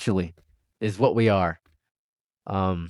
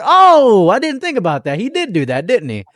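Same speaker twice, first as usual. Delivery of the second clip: surprised realization; 6 semitones higher than usual. Retold or imitated. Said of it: "I was like".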